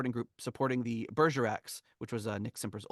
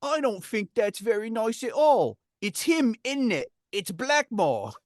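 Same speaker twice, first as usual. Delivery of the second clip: hammed-up French accent, much higher and louder; 9 semitones higher, 6 dB louder, slower than usual. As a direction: read the quote in a big mock French accent, Blackmore slowed right down. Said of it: "he's writing in this accent".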